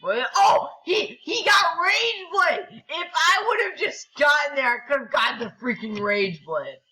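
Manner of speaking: high, loud, drawn-out wail of frustration; real gamer rage. Real, not acted. Gamer losing out on Rage Blade, outraged shouting.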